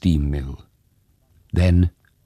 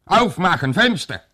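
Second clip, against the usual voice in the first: much higher, louder German command; German shout; 12 semitones higher, 4 dB louder than usual. Acted. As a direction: demand it in German, harder. German soldier repeats.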